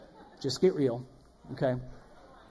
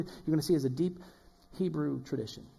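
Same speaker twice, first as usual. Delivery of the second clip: usual speaking voice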